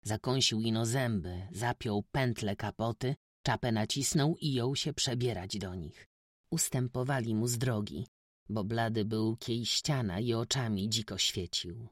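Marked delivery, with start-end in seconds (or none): none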